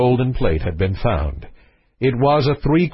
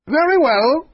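high-pitched royal reply; king's voice; 13 semitones higher than usual. Acted. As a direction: answer as the excitable king, pitched well up. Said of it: "said the king".

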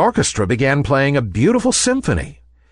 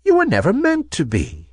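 put-on higher-pitched old woman voice; gentle old lady; 10 semitones higher than usual. A narrator's reading. Old lady revealing herself to the ballerina, gentle. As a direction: explain kindly as an old toy maker. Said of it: "said the old lady".

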